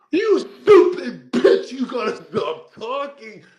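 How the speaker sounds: high, very loud yell, first words drawn out; acted-out furious high shout; exasperated outburst. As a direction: explode at her in exasperation. Acts exploding at girlfriend's reply.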